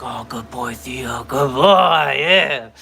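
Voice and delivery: very slow, drawn-out mock praise; mock sleepy praise. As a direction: drag it out like a half-asleep owner. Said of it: "I'm gonna be like".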